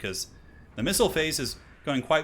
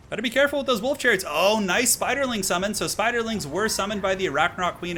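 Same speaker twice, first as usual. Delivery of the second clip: excited warning; 8 semitones higher, 5 dB louder than usual. Real, not acted. Caster warning about the chariots, then cheering, excited. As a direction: blurt the warning, then savor the summon. Caster reacts to sudden summon.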